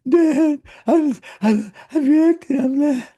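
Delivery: imitating his young son, high and loud; upset little son. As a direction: voice a worked-up small boy on the phone. Son calling upset, voiced by dad.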